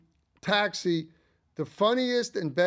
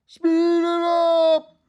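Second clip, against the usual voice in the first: mock shout; 14 semitones higher, 12 dB louder, much slower than usual. Retelling the chocolate scene.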